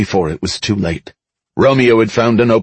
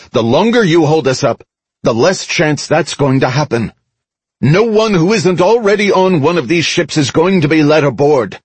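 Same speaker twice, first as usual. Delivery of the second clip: higher-pitched, emphatic public appeal; firm crowd address; 7 semitones higher than usual. A narrator's reading. Mickey persuades the crowd to release the ships, firm address. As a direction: press the crowd firmly to give way.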